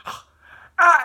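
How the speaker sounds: sudden high, loud scream; gasp, then scream in mock pain; imitated cartoon shriek of pain; acted scream. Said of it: "he screams".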